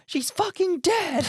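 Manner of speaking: high squealed outburst, hammed up; mock shriek. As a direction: squeal it like a dramatic reveal. Mock exclaiming character's belief.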